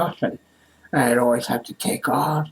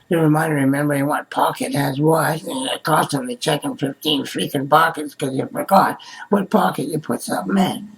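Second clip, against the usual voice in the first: ordinary delivery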